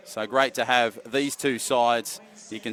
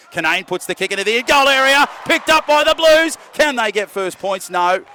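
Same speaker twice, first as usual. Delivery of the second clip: much higher and louder, thrilled; excited call; 6 semitones higher, 8 dB louder than usual. Real, not acted. Commentator calling a near try to Benny, excited high loud call. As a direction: lift the call as the kick lands. Kick into goal area.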